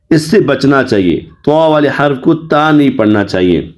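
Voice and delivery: ordinary talk, nothing marked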